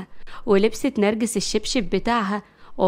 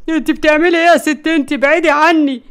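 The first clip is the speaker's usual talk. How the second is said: high, loud yelp of complaint; pained protest; 5 semitones higher, 6 dB louder than usual. A narrator's reading. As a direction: cry out while being hit. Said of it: "مروان بوجع قال". (بوجع وصوت عالي)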